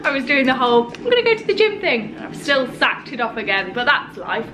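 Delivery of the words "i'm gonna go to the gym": 1 mock self-quote. Mocking her own plan.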